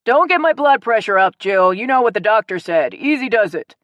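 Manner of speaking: half-joking loud warning; piping up. A narrator's reading. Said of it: "he piped up".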